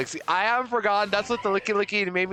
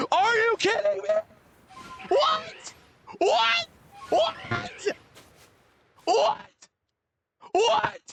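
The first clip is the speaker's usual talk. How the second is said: squeaky high raging yelps; high-pitched outraged what; rage voice; slower than usual. Real, not acted. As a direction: freak out in a shrill high rage. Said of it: "The same mocking rage voice".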